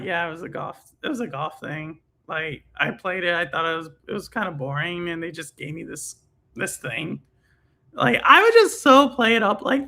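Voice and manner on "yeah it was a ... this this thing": softer, offhand and unimpressed; super nonchalant. Pretend. Downplaying a lowest-score trophy to friends, nonchalant. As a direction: shrug it off like it's nothing. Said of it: "like, super nonchalant".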